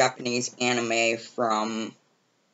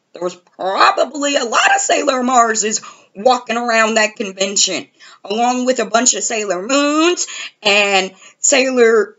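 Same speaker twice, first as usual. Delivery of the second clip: higher, louder mock scolding; exasperated scolding; 6 semitones higher, 3 dB louder than usual. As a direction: tell him off, done with it. She scolds Justin as a dope.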